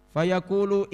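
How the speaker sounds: normal talking voice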